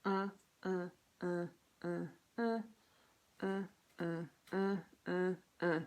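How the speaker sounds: strained uh sounds with the tongue pushed up; tongue exercise grunts. Shown as a demonstration. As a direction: grunt through the exercise, mouth held open. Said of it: "So like this".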